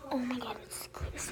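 hushed whisper, barely voiced. Real, not acted. Kid filming, whispering to viewers. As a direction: whisper so nobody hears.

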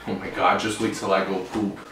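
ordinary talk, nothing marked

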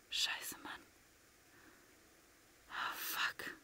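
soft unvoiced swearing; whispered curses. Real, not acted. Nervous player whispering curses near victory. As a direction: curse under your breath. Tense endgame.

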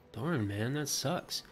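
ordinary delivery

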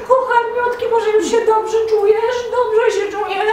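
high sugary sweet-talking voice; fawning goat. Acted. Goat begs the ox to take the plough back, fawning and high. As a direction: flatter him sweetly, pleading.

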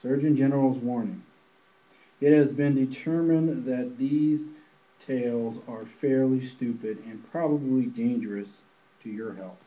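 loud, brisk announcer read; hammed-up public notice voice; official warning read. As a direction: rattle it off like a mock health warning. Reads spoof official warning.

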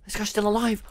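ordinary delivery

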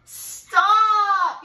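big loud booming stop; gorilla's yell. A narrator's reading. Gorilla cornering the mouse in the Arctic. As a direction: bellow it to halt her. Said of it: "yelled the gorilla".

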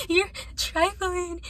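a touch of tearful accusation; upset. Retold or imitated. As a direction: imitate the crying girl, high and slow. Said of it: "she was, like, really, really upset".

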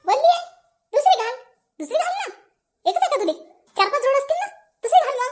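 very high, incredulous teasing; amused exclaiming. Acted. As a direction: pipe up high in amused disbelief. गीले कपड़ों की शिकायत पर मज़ाक.

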